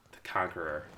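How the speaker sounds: soft, low delivery after a beat; hushed quote. Retold or imitated. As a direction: let it land softly and low, imitating her. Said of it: "It's a full pause, and she goes".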